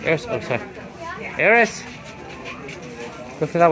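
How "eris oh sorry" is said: ordinary talk, nothing marked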